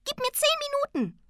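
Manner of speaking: very high, squeaky little creature voice; rufendes Tingeli. A narrator's reading. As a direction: call out in a tiny high voice. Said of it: "rief das Tingeli". (hohe Piepsstimme, rufend)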